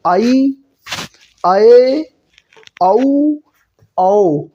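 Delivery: each of "आई, आए, औ, औ" a drawn-out two-vowel glide; correct pronunciation. Shown as a demonstration. Teacher modelling pure vowel sounds, careful and separated "आई, आए, औ, औ". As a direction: sound out "आई, आए, औ, औ" one by one, pausing between. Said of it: "शुद्ध उच्चारण यही होता है".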